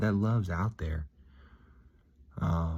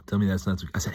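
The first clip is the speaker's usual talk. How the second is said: ordinary talk, nothing marked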